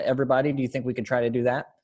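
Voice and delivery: ordinary delivery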